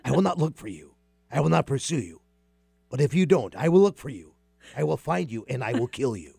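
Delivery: low, menacing, measured threat, only lightly done; Liam Neeson voice. Acted. Host doing the Taken threat for the co-host. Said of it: "I wanna hear the voice".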